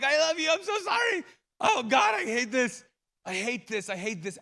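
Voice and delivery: high, loud anguished outcry fading down; anguished cry. Retold or imitated. Father crying out over his burned son, anguished. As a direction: cry out in pain for your child.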